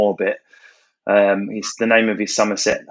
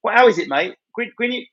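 higher, stumbling mock compere; compere struggling; 10 semitones higher than usual. Compere fumbling the surname, mock puzzled voice. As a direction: fumble the name like a confused host. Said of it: "he picks up a list and goes".

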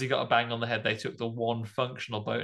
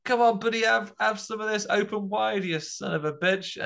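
taunting hero; 9 semitones higher than usual. Retold or imitated. Brody taunting shark, acted.

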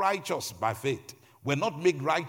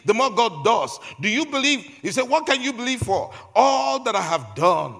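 loud, high, driving sermon delivery; raised-voice preacher building with insistence; impassioned preaching; 7 semitones higher, 8 dB louder than usual. Real, not acted. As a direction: preach it at full force, punching each phrase. Preaches at full force.

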